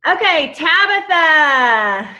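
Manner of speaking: ordinary delivery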